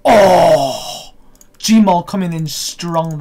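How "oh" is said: long, loud cry of amazement. Real, not acted. Gamer watching the Gmaul come in strong, loud amazed cry. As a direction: bellow a drawn-out oh.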